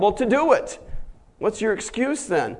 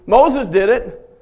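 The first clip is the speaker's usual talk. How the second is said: normal talking voice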